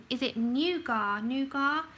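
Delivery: NOUGAT said as "NOO-gah", silent t; French-style pronunciation. Shown as a demonstration. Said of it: "How do you pronounce that?".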